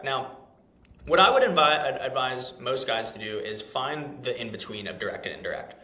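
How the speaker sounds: normal talking voice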